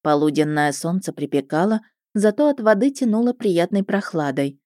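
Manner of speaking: usual speaking voice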